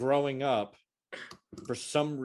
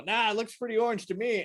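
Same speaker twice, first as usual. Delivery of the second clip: stubborn; 11 semitones higher than usual. Retold or imitated. Stubborn kid retold.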